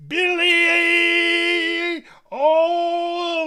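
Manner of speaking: high, loud, drawn-out fight announcer; ring announcer. Retold or imitated. Game master voices the wrestling announcer to the crowd, booming. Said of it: "the announcer going".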